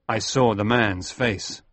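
usual speaking voice

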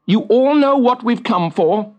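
stern. Acted. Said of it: "said Fricka sternly".

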